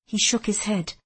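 plain reading voice

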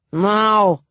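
loud slurred now; drunken slur. A narrator's reading. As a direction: slur it like a tipsy nobleman. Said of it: "he slurred".

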